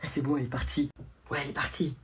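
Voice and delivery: hushed whisper, so mum won't hear; whispering while hiding. Acted. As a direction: keep it under your breath, hiding. Kids whispering after mum leaves.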